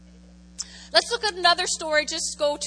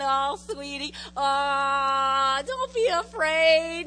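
mock sweet comfort; much slower than usual. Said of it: "patting your little hand".

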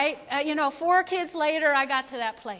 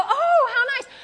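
high laughing coo; giddy laugh; 10 semitones higher, 6 dB louder than usual. Retold or imitated. Pleased by the receptionist's compliment, laughing. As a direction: gush through a laugh. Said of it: "I would go".

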